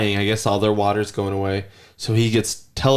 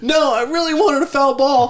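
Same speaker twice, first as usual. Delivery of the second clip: put-on high, whining little boy; whiny kid voice; 3 dB louder than usual. Father imitates his son's whiny protest. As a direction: plead it like a sulking child. Son whining about foul ball.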